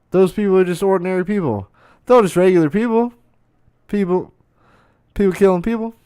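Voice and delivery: high, fast, incredulous burst; astonished realization. Real, not acted. Realizing anti-vaxxers are regular people, high astonishment. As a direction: let the amazement push the voice up.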